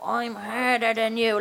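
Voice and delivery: put-on drawn-out Dublin addict accent; mock hard Dublin accent. Panellist mimicking someone acting tough, drawn-out Dublin accent. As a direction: stretch it slowly in an imitated Dublin accent. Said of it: "trying to do it to be real".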